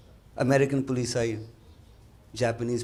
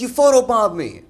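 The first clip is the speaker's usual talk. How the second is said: friend's exclamation; 11 semitones higher, 11 dB louder than usual. Retold or imitated. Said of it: "he says".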